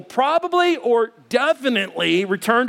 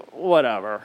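low, offhand mock shrug; deep, couldn't-care-less delivery; dismissive; 9 semitones lower than usual. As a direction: drop the voice low and brush it off. Same person later dismissing the cookie.

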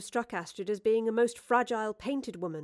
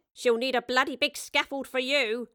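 smirking; 4 semitones higher, 3 dB louder than usual. A narrator's reading. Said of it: "Mary smirked".

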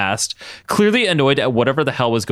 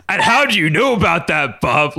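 crying out; 6 semitones higher than usual. A narrator's reading. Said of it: "Logan cried".

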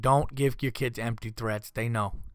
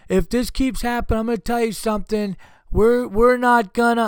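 raised, higher mock-threatening dad voice; stern dad threat; 9 semitones higher, 6 dB louder than usual. Dad warning his kids, raised stern voice. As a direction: lay down the law to the kids, loud.

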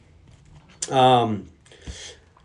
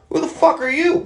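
much higher, incredulous squawk; mock soldier; 12 semitones higher than usual. Airmen answering Sosa in the flight ops room. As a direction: squawk it in disbelief.

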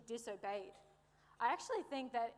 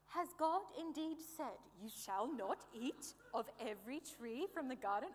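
a lightly put-on British accent for the serpent; a hint of a sly British serpent, barely held; faint British accent as the cunning serpent, quickly dropped. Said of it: "the serpent with a British accent".

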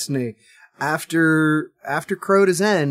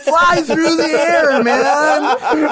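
loud, high surfer-dude hype; overexcited bro voice; hyped-up bro; 10 semitones higher, 4 dB louder, slower than usual. Retold or imitated. Mocking hype of the ad.

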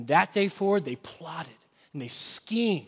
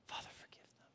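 breathy whisper of a dying man; hushed plea from the cross; whispered prayer; 24 dB quieter, much faster than usual. Acted. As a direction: whisper it, pleading. Jesus's prayer voiced on cross.

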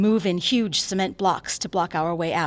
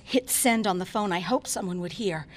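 ordinary delivery, nothing marked

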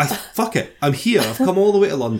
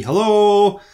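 calling out; 9 semitones higher, 5 dB louder than usual. Retold or imitated. Calling back down stairs.

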